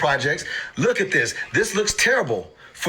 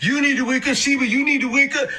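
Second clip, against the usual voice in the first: much higher, laughing whine at the coin; mock pleading with coin; 8 semitones higher than usual. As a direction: nag a sleepy coin to pump. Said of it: "You start talking like that".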